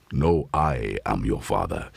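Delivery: low, slow, menacing villain voice; movie villain line. Pretend. To the cat, a low slow villain voice. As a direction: intone it like the dark lord in the film.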